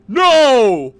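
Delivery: sudden high, loud scream; [name] yells. Acted. [name] screaming as Mary is shot, loud and high. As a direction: scream it in horror.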